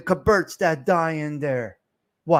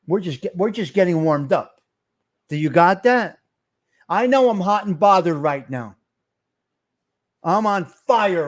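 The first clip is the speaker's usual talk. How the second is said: ordinary talk, nothing marked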